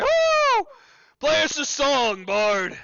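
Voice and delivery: very high, loud rowdy cheer; crowd cheering. Acted. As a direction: whoop like a tavern crowd. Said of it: "a whole lot of cheering".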